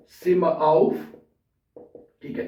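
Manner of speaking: ordinary talk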